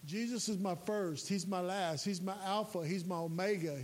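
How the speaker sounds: ordinary talk, nothing marked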